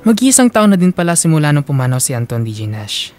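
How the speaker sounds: ordinary talk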